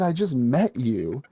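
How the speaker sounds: drawn-out incredulous protest; mock exasperation. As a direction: protest slowly, in disbelief, stretching the words. Voices girl protesting to hero.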